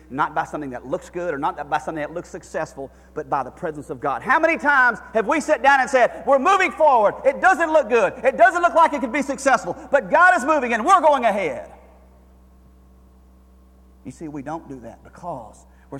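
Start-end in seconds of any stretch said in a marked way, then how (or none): 4.2-11.7 high, loud preacher's cry to God; impassioned sermon climax; full-force preaching. Acted. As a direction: plead it from the pulpit, full voice.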